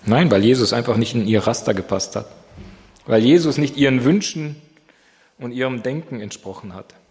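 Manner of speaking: normal talking voice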